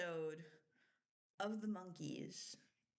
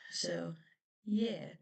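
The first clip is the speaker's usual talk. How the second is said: usual speaking voice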